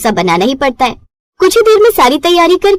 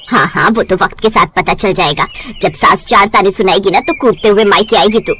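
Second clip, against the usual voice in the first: lower, knowing mother's warning; mother warns daughter she'll come running back, lower voice; 5 semitones lower than usual. Acted.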